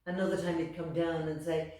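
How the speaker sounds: usual speaking voice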